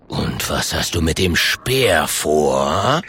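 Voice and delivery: low, slow, looming bear voice; menacing bear. Acted. The bear asks about Klonk's spear, menacing. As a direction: draw it out deep, rising at the end.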